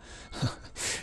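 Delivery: quiet, brief chuckle; soft laugh. Real, not acted. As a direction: chuckle softly to yourself. Laughs at nobody listening.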